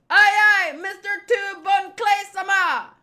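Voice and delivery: high, loud crew response; eager aye-aye at full volume; shouted acknowledgement. Acted. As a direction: shout it like an obedient underling. Underlings answer the boss's orders.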